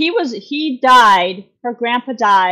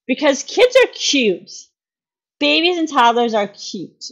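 ordinary talk

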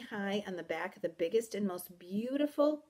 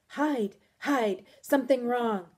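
urgent, louder, higher; alarmed warning; 3 semitones higher, 6 dB louder than usual. A narrator's reading. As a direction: warn them to hide, frightened. Guest hides, warning of danger.

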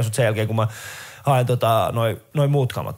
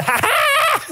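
high mocking hähää; gloating flasher; much slower than usual. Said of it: "se tulee sille siitä".

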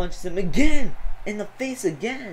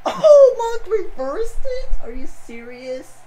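much higher, louder yelp of shock; excited disbelief; 14 semitones higher, 8 dB louder, slower than usual. Real, not acted. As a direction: cry out as the reversal lands. Stunned by surprise reversal.

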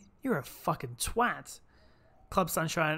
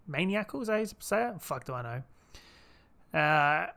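soft-voiced comforting friend; 4 dB quieter than usual. Gamer reading a friend's lines to Kanahara, lightly voiced and gentle. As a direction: read it like a soothing companion letting go. Voicing game dialogue as character.

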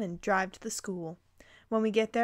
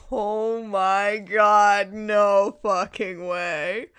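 slow, drawn-out disbelief; stunned disbelief; 5 dB louder, much slower than usual. Real, not acted. Reader stunned by the story, slow amazed disbelief. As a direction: let it sink in, slowly and amazed.

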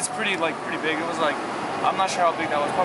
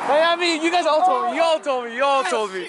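playful concession; 9 semitones higher than usual. Real, not acted. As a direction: repeat it with mock exasperation. Conceding friends were right.

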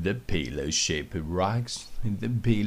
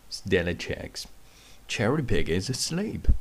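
ordinary delivery

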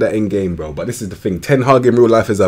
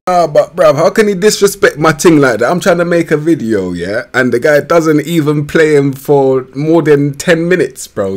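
excited rant; 6 semitones higher, 6 dB louder than usual. Real, not acted. Ranting at the manager's snub.

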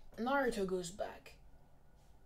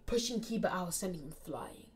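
ordinary delivery

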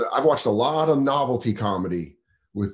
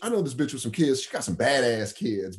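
high-pitched, rapid-fire stand-up impression; Def Jam comic; 5 semitones higher than usual. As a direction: start way up high and tumble fast through the bit. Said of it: "perfect mimic of a Def Jam comedy".

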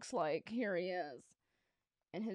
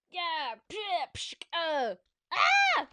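high, loud mouth-made punch noises; fight sound effects; 8 semitones higher, 6 dB louder, much slower than usual. Retold or imitated. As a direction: make cheesy fight noises. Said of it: "my terrible sound effects".

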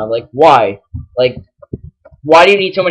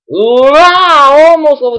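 1 excited wow; 10 semitones higher, 6 dB louder, slower than usual. Real, not acted. Reacting to level up.

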